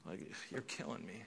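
barely there, a parent worn out; weary parent. Retold or imitated. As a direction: sigh it out like a drained parent. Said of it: "a parent just goes".

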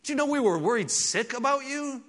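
a mother begging her son; worried mother. Retold or imitated. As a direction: plead like a frightened mother. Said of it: "it's like her saying".

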